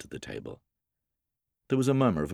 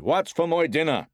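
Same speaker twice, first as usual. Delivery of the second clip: loud, high angry shout; bellowing father banging the table; shouted demand; 12 semitones higher, 6 dB louder than usual. A narrator's reading. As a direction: shout for your dinner. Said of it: "banged his fist on the table and shouted".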